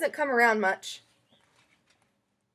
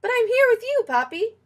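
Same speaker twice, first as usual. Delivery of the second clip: put-on much higher, louder little-girl voice; bright high child protesting to grandpa; child's reply; 10 semitones higher, 6 dB louder than usual. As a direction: squeak it out like a small child. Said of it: "And I say".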